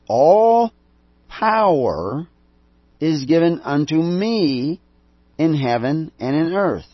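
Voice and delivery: All power is given unto me, with "All" punched high and loud and "power" drawn out; stress on All. Retold or imitated. Preacher quoting Jesus to the congregation, hitting "All" hard.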